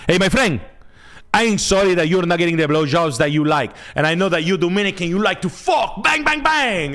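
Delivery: mock a Dominican guy to tease him; mock Dominican accent. Teasing a viewer in a hammed-up Dominican accent.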